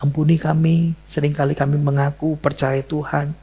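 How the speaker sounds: soft, quiet prayer voice; closing prayer. Acted. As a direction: lead the prayer gently. Memimpin doa penutup. (doa penutup yang lembut)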